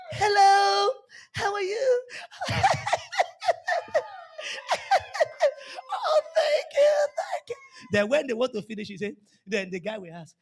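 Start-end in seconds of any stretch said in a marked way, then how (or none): 0.1-7.6 put-on much higher, giggly, whiny girl's voice; hammed-up simpering girl on a call. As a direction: squeal and giggle down the phone. Imitates unmarried girl on phone.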